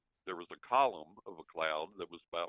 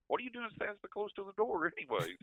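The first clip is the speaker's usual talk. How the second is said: much higher, puzzled buddy's voice; retold buddy; 13 semitones higher than usual. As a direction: pitch it way up as the startled friend. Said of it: "He said".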